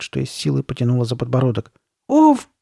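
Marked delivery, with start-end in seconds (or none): 2.1-2.5 sharp, very high yelp; short high pained "oof"; cry of pain. A narrator's reading. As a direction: yelp loudly as if it hurts. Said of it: "вскрикнула она от боли".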